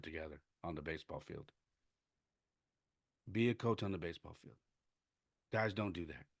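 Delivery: very soft, spent voice after crying; subdued pleading. Real, not acted. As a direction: urge him gently, well under normal volume. Drained after tears, urging player.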